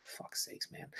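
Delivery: under-the-breath whispered "Fuck's sakes"; barely voiced sigh of disbelief; exasperated whisper. Real, not acted. Streamer reacting to a crude chat comment, whispering in disbelief.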